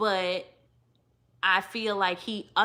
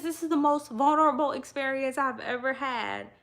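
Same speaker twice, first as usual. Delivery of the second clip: mock quote; 5 semitones higher than usual. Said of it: "she says".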